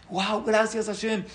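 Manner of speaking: high, slow awestruck gratitude; amazed thanks. Acted. Jaim thanking Hashem for the letter, amazed thanks. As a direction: stretch it out in grateful wonder.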